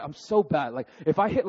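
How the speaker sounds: ordinary talk, nothing marked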